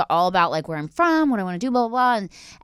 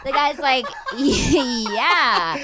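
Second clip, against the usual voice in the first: laughing; 7 semitones higher, much slower than usual. Real, not acted.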